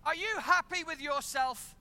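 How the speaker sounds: woman confronting her ex-husband, shouting; angry shout. Retold or imitated. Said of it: "she starts to shout louder".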